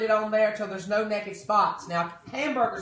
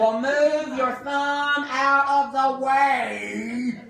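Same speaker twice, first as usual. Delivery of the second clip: louder, slower put-down; loud slow retort; 4 semitones higher, 6 dB louder, much slower than usual. Acted. Cook retorting to a complaining customer, loud and slow. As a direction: fire back slowly at the complainer.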